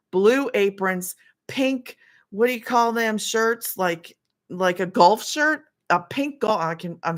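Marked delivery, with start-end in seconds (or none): none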